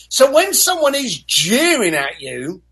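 usual speaking voice

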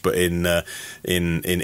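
ordinary talk, nothing marked

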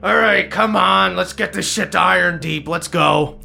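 put-on grumpy old captain. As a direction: bark orders like a grumpy captain. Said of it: "this grumpy old captain go".

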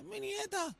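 imitating a crying groom. Gossiping how [name] cried at the wedding, soft high mock sob. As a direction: squeak it out like a teary groom, mock. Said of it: "Llorando, decía".

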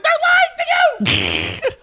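very high, drawn-out screech; mock yelling. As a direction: scream helplessly at the TV, hammed up.

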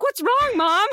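imitating her kids; kids asking. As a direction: ask like a worried child. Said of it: "My kids were like".

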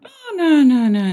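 sudden high, loud "Oh" sliding into quick no's; a mother's alarmed tone, high then falling; alarmed motherese. Shown as a demonstration. Mother warning her baby, high alarmed oh no no. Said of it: "that's a very different tone".

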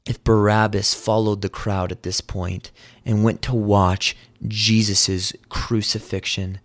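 usual speaking voice

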